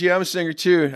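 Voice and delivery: high and loud rocker's "I'm a singer too"; mock rocker voice. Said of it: "He goes".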